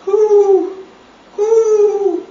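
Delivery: loud cooing pigeon. Pretend. Mother to baby at the pigeon picture, loud cooing. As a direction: coo it out like a pigeon. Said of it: "It says".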